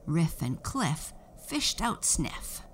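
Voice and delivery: usual speaking voice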